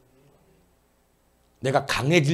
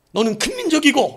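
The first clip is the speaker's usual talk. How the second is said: much higher, louder proclamation; grand declaration; 13 semitones higher, 8 dB louder than usual. Acted. Pastor voices God declaring a great nation, loud and high.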